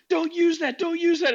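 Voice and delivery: high, loud mocking panic; squawked mock protest; mock outrage. Mimicking oversensitive people objecting.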